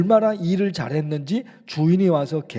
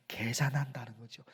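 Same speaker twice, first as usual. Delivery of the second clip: whisper; 5 semitones lower, 15 dB quieter than usual. Real, not acted. Whispered aside in sermon.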